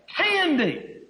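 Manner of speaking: put-on high-pitched excited cry of a child; emphatic exclamation. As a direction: imitate kids exclaiming over a treat. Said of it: "they were emphasizing".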